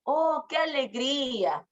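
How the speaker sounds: acted happiness, melodically stretched; emotive joy. Shown as a demonstration. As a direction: draw out a demonstrated joyful sigh of words.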